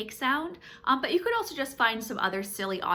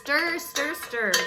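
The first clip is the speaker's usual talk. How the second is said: "Stir" with a long hissing S; 6 semitones higher, 4 dB louder, slower than usual. Shown as a demonstration. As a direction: stretch the S of "stir". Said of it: "keep emphasizing that S sound".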